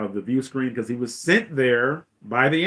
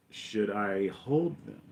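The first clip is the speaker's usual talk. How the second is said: slow, soft, lower query; tentative question; 4 semitones lower, 8 dB quieter, much slower than usual. Retold or imitated. Admiral asks Vader about the shuttle, tentative and slow. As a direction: ask a superior carefully, drawn out.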